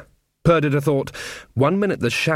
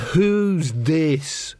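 muttered sideways, slow and higher; a sidelong mutter so only the friend hears; out of the corner of her mouth; 5 semitones higher than usual. A narrator's reading. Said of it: "out of the corner of her mouth".

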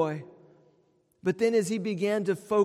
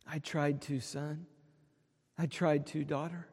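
soft, low, tender reply, much quieter; gentle God; 3 semitones lower, 10 dB quieter than usual. Pretend. God answers his children at heaven's gate, soft and tender. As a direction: answer like a loving father, gently.

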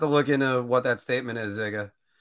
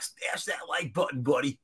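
cheesy YouTuber sign-off; hyped YouTuber voice; 6 dB quieter than usual. Retold or imitated. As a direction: pitch it like a typical YouTuber. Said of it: "be like every other YouTuber".

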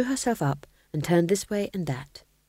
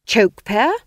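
louder, higher desperate guess; 5 semitones higher, 9 dB louder than usual. A narrator's reading. Ghost king guessing desperately, louder and higher. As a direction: throw out a last hope. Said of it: "he said desperately".

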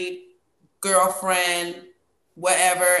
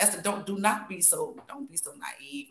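retold self-warning; 8 dB quieter than usual.

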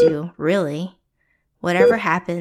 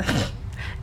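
soft unvoiced chortle; breathy chortle; 13 dB quieter than usual. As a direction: chuckle darkly under breath. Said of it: "She chortled".

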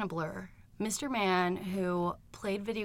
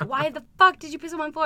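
fast, high, loud retold demand; imitated yelling; 7 semitones higher, 7 dB louder, faster than usual. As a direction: yell it quickly as the outraged boyfriend. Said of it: "he was yelling at me".

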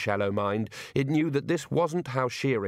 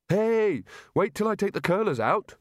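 shouting after it; 9 semitones higher than usual. Acted. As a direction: yell it after the fleeing sheep. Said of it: "Rincewind shouted after it".

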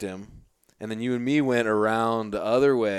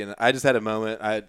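ordinary delivery

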